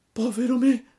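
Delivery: high, loud lament; first cry of despair. A narrator's reading. Pinocchio learns he becomes a donkey, crying out. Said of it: "gridò Pinocchio".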